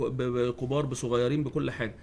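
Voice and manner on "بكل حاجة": usual speaking voice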